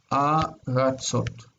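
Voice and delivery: aratzot, slow and drawn out, stress on the final syllable; slow Hebrew pronunciation; Hebrew word model. Shown as a demonstration. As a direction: say aratzot slowly with the weight on the last syllable. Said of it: "this one's distant and it's reduced".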